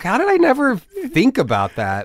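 high-pitched incredulous question; retold disbelief. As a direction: replay being amazed at yourself. Replaying his own disbelief.

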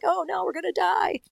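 a hint of a scared coward; panicky guy voice. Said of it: "kinda guy".

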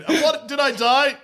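high, incredulous questioning; high mock alarm with a laugh in it; startled crab. Acted crab shocked in heaven.

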